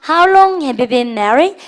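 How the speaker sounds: slow classroom repeat-after-me; very slow drill reading. Shown as a demonstration. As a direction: say it slowly so students can follow. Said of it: "시작".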